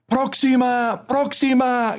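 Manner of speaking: very high-pitched call; shouting. A narrator's reading. Man at the back calling his stop, shouting. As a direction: cry out for the bus to stop. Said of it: "gritaba un señor".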